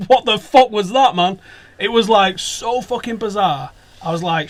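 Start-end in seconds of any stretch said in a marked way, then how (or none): 0.1-1.4 slow, loud, laughing disbelief; bewildered laugh. Retold or imitated. Recalling his baffled reaction to the players, laughing.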